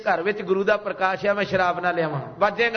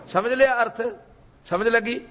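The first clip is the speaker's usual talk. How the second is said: ordinary talk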